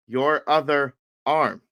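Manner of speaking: slow, deliberate words; retold slow repetition. Coach repeating to a confused student, slow deliberate repetition. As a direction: spell it out word by word.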